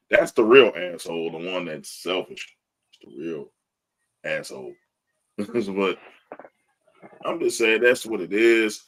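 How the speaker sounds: ordinary talk, nothing marked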